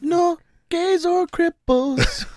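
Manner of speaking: high, very slow mock Jamaican accent; Jamaican Nazi parody. Echoing the co-host's lyric parody, drawn-out Jamaican accent. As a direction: stretch it out in cod Jamaican. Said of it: "To Jamaican Nazis".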